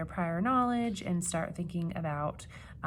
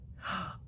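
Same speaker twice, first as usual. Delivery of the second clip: startled gasp; 8 dB quieter than usual. Acted. Animals startled by a noise.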